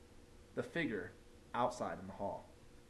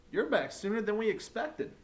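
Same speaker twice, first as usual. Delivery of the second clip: higher, louder surprised principal; 9 semitones higher, 4 dB louder than usual. A narrator's reading. Principal greeting the returning teacher, surprised. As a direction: lift it up in surprise.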